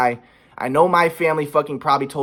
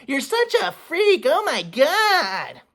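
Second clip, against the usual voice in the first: much higher, slow mock of mom scolding; 12 semitones higher, slower than usual. Son imitates mom scolding him, high mocking voice. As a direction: sneer it as his mom, pitched way up. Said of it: "And my mom was like".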